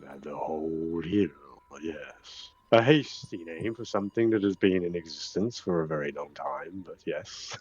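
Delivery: deep, unhurried nature spirit; ancient hill creature. Acted. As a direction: speak softly and ponderously, with long pauses. Game master voices the hill.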